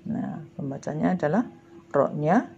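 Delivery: normal talking voice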